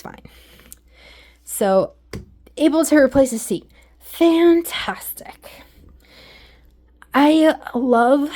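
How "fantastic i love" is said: breathy whisper. Real, not acted. Slow whispered praise of seat.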